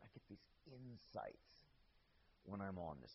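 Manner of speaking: mock the spaced-out pot smoker quietly; imitating a stoner. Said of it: "You ever heard anybody talk like that?".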